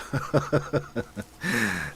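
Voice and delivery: real run of soft chuckles; quick, pulsing chuckle; amused chuckling. Real, not acted. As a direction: laugh lightly at your own remark. Amused at overflowing shareholder confidence.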